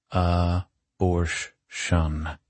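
very slow, syllable by syllable; "A-borsh-shun" broken into three drawn-out pieces; sounding it out. Acted. Character sounds out dreaded word.

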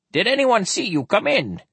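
high-pitched, anxious question; nervous. Acted. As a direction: ask it nervously, voice pitched right up. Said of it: "the big-nosed man asked nervously".

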